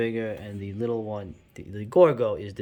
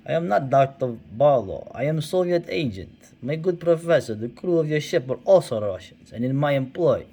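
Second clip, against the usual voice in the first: a hammed-up Russian villain accent, a little higher and louder; mock Russian spy accent; 4 semitones higher, 5 dB louder than usual. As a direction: play the Soviet agent unmasking himself in a Russian accent. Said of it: "I had to do a Russian accent".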